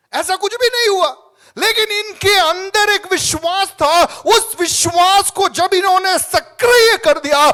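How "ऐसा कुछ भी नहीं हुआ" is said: normal talking voice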